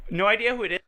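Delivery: high sing-song mock; mocking imitation. Mimics own line again.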